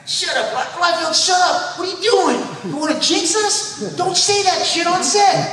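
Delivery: hammed-up panicked crew telling him off; mock scolding.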